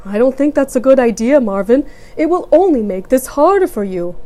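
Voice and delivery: firm but kind dad; father voice. A narrator's reading. Papa refuses gently.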